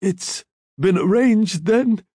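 high, insinuating question; drawn-out, cunning dying man's query; sly. A narrator's reading. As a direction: ask slyly, as if in on a scheme. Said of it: "Abundando said slyly".

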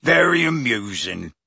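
mildly growled sarcasm. A narrator's reading. Hagrid sourly concedes, growling. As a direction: grumble it in character. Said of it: "growled Hagrid".

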